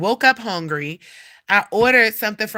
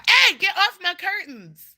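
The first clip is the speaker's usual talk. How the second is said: sharp, high-pitched scolding; real exasperated yell at a pet; calling off the cat; 10 semitones higher than usual. Real, not acted. Vlogger tells her cat to get off the curtains, scolding.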